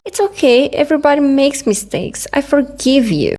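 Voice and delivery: high, sweet naive voice; naive inner voice. Retold or imitated. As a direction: play your own gullible inner voice. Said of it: "my brain was like".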